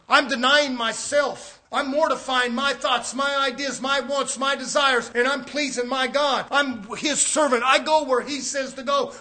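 loud, fervent declaration of surrender. Retold or imitated. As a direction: proclaim it like a faithful servant. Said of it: "Christians don't speak like that".

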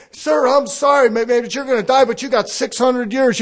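lightly voiced sorry doctor; apologetic doctor. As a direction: break it gently like a doctor. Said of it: "the doctor come to you and said".

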